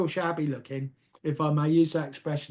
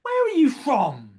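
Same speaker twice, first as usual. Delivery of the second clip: higher, slower, louder question; retelling Mother asking the newcomer where he is from, high and slow; imitating Mother's question; 7 semitones higher, 5 dB louder than usual. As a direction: ask it grandly and unhurried, as Mother. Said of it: "she said".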